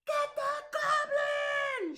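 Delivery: high, loud, slow shout. Acted. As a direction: roar it as a mob. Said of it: "shouted everyone".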